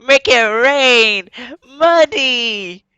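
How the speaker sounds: slow, high, loud drawn-out call; stretched hammed-up make it rain shout; mock big spender. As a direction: ham up a drunk guy showering cash. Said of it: "He just, like".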